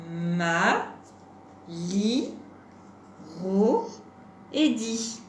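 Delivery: "Ma, li, ro et di." one syllable at a time, pauses between; syllabes isolées. Shown as a demonstration. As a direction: separate each item in "Ma, li, ro et di.". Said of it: "On va redire les différentes syllabes". (« Ma, li, ro et di. » syllabe par syllabe, avec pauses)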